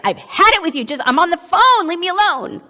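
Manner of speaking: loud, high exasperated outburst; fed-up snapping. Acted. Parent on the phone to toddler, fed up. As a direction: snap at a pestering child to back off. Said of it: "Not very attuned".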